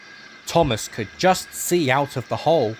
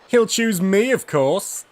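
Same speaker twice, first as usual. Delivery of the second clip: high, loud proud boast; 7 semitones higher, 3 dB louder than usual. A narrator's reading. James brags to the engines in the shed, boastful. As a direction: boast it, sure of yourself. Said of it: "boasted James the Red Engine".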